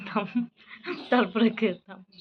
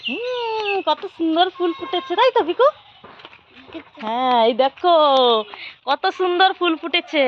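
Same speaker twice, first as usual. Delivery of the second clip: squeaky-high cooing over a flower; 6 semitones higher, 4 dB louder, slower than usual. Real, not acted. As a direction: delight the little one with the flower. Showing flowers to toddler.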